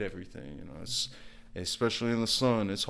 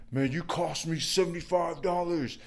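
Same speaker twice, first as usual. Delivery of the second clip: high-pitched mock-irate rant; imitating angry customer; 8 semitones higher than usual. Customer demanding half his money back, high mock-angry rant. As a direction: complain like a belligerent client demanding a refund. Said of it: "angry on the phone, yelling, cursing at me".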